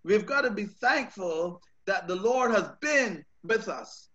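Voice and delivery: ordinary delivery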